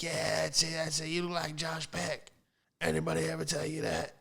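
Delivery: mock Southern lady accent; Southern smoker's accent. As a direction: confirm it excitedly with a put-on Southern twang. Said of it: "deep cigarette Southern accent".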